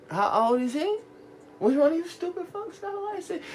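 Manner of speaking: high, scolding disbelief; mock yell of disbelief at dumb friends; incredulous yelling at friends. Yelling at friends about who has a license, incredulous.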